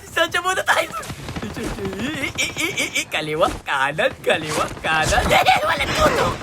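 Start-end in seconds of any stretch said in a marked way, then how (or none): none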